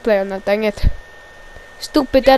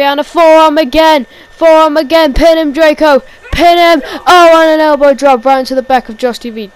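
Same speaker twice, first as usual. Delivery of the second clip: loud, higher-pitched wrestling call; excited play-by-play; 4 semitones higher, 8 dB louder than usual. Real, not acted. Commentator urging Draco to pin him, excited shout. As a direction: shout the moves as they land. Calling a wrestling attack live.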